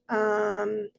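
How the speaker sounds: normal talking voice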